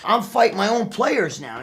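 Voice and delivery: usual speaking voice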